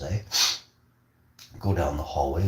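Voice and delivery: ordinary delivery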